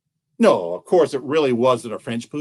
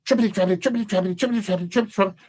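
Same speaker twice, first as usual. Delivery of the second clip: quick, light, higher footstep chant; higher, hurried "trippity, trappity"; squirrel scurrying; 4 semitones higher, 4 dB quieter, much faster than usual. Acted.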